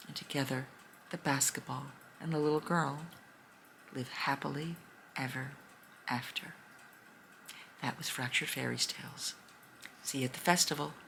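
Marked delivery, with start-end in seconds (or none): 0.1-6.5 hushed happily ever after; storybook ending. A narrator's reading. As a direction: wind the story down gently.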